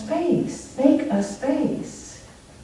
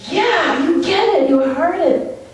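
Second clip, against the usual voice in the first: higher, bright relief at being heard; mock relieved woman, voice pitched up; 7 semitones higher than usual. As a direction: lift it up, finally understood. Woman relieved in a retold video.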